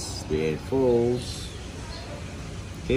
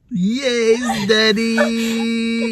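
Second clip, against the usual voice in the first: put-on baby voice; 13 semitones higher, 4 dB louder, much slower than usual. Dad answers for the baby.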